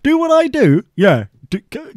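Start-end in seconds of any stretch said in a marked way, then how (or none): 0.0-0.8 high, disbelieving repeat; baffled question back to the listener; incredulous echo. Real, not acted. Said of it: "That was a direct quote".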